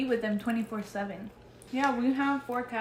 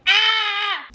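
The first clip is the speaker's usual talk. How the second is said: pained yelp; 10 semitones higher, 11 dB louder than usual. Real, not acted. Reacting to burning mouth.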